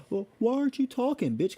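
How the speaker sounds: high whiny mocking voice; mocking them. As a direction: mimic them in a high whine. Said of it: "they're like".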